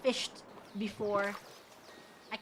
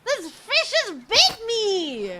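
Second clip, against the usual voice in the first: loud slow high indignation; hammed-up shocked outrage; outraged; 8 semitones higher, 9 dB louder, slower than usual. Real, not acted. Fish bites player.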